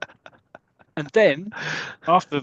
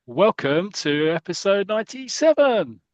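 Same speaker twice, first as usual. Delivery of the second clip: big slow announcer welcome; 6 semitones higher, 5 dB louder than usual. Real, not acted. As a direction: boom it out like a show host. Announcing the episode number.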